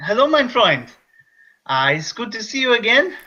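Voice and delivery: a clumsy German doctor greeting; German accent, overdone; mock German accent. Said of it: "I'm mixing up accents".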